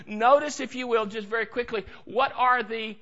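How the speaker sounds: ordinary talk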